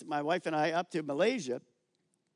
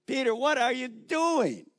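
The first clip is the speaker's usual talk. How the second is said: higher, louder teasing call to Peter; Jesus ribbing Peter among his disciples, higher and louder; playful Jesus; 6 semitones higher, 4 dB louder than usual. Acted. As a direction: chide a friend with a grin.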